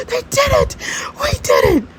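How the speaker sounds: very high, gasping excitement; high-pitched celebratory cry; thrilled squeal. Acted. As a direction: squeal in triumph. Celebrating the couple reuniting.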